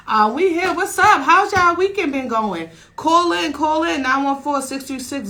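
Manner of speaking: high, loud host energy, real excitement; excited hype. Real, not acted. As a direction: pump up the listeners calling in. Hyping the radio audience.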